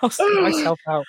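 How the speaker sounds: put-on voice. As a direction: sound like a silly stranger. Character voice in sketch.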